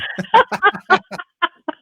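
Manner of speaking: bright burst of laughter; loud high laugh. Real, not acted. Laughs after announcing.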